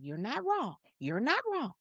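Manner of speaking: soft, careful retold agreement; placating agreement. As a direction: back her up quietly to calm her. Placating the ranting friend.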